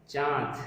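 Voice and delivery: चांद with a nasalised आ, no separate n; nasalised vowel. Shown as a demonstration. Teacher showing students the correct चांद, nasalised vowel without full n. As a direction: say चांद through mouth and nose together. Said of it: "इसका उच्चारण मुंह और नाक की सहायता से".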